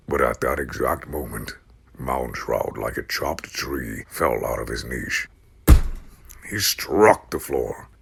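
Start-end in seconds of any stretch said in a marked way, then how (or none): none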